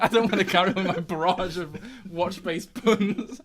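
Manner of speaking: real laughter breaking through the words; loud, giggly protest through laughter; laughing. Real, not acted. Host to co-host about his own awful puns, laughing. Cracking up during intro take.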